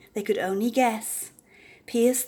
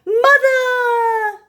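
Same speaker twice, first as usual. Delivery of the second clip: much higher, loud joyful cry; called out; 11 dB louder than usual. A narrator's reading. The beast calls out to his mother, loud cry. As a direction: cry out across the crowd. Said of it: "a voice called out".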